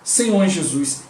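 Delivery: ordinary talk, nothing marked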